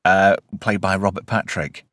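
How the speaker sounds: normal talking voice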